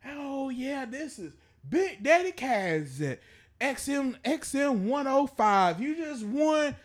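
high, loud, slow radio announcer. Retold or imitated. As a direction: hype it like a prize-call DJ. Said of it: "I'm like".

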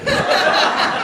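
quick quiet laugh under the breath; soft breathy laugh. Real, not acted. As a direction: let a soft laugh slip out. Laughing at his own story.

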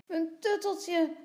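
put-on high, loud childish whine; tearful little boy. A narrator's reading. Tommy cries to Lotje for his comforter, wailing. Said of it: "huilt Tommy weer".

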